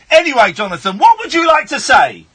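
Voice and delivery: normal talking voice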